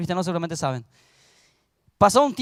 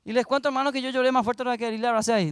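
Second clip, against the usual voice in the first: ordinary talk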